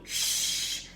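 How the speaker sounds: unvoiced hush; shush. A narrator's reading. Hagrid hushing Harry in the library. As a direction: hush them again.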